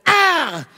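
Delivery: very high loud "Ah!" shout; Bruce's loud holy-spirit yelp; sudden shout. Retold or imitated. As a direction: blast out a sudden high cry. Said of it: "and he goes".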